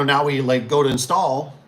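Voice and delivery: normal talking voice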